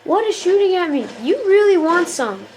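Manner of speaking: high, loud indignant outburst; riled challenge. Real, not acted. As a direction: challenge the unseen attacker, riled up. Riled by unseen attacker.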